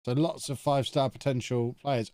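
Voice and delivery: usual speaking voice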